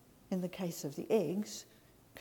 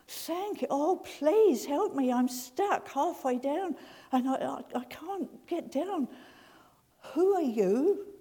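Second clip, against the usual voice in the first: high, panicky pleading, stammering; desperate man; 7 semitones higher, 6 dB louder than usual. Acted. Man stuck on the cliff begging the voice for help, high and frantic. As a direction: beg for rescue, voice up high.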